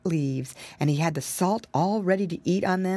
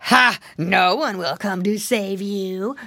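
snarl; 4 dB louder than usual. Acted. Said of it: "snarled the bad fox".

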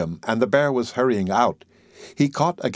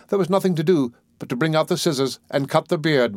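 plain reading voice

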